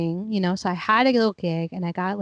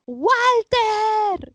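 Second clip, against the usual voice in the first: high, drawn-out call of Walter!; 11 semitones higher, 3 dB louder than usual. Retold or imitated. Kid calling the TV host's name, high and drawn out. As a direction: call Walter! across the living room, high and long.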